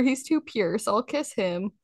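ordinary talk, nothing marked

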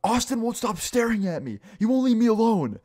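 high-pitched mock tantrum yell; retold yelling. Classmate complaining to the teacher, mock high yelling. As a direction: imitate a kid yelling a complaint at the top of his range. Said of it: "what are you yelling about".

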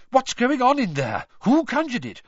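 high, loud anxious questions; alarmed crowd. A narrator's reading. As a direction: fire off urgent questions in a high voice. Frightened crowd questions an official.

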